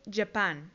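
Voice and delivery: "Japan" said ja-PAN, with an English j; English pronunciation. Shown as a demonstration. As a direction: model "Japan" for learners, falling tone. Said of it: "Japón se dice". ("Japan" pronunciado en inglés, con j de "yapán")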